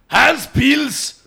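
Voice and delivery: put-on high, harsh German accent; mean German voice. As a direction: say Hans Pils like a stern German. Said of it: "Like, really mean German".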